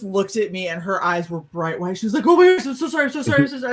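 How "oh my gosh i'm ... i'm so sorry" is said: high, frantic run of apologies; loud at first, then tumbling on; panicked apology. Retold or imitated. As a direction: imitate a horrified friend apologising. Friend panics after hitting her.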